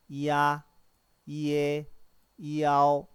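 이아, 이에, 이아오 drawn out and level, one at a time; pronunciation review. Shown as a demonstration. Teacher reviews sounds starting with 이, held evenly. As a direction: sustain each glide in 이아, 이에, 이아오. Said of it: "단음모 이로 시작하는".

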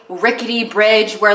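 ordinary talk

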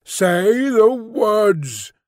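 high, very drawn-out order. A narrator's reading. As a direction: stretch every word as an unseen beast demanding obedience. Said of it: "repeated in a louder tone".

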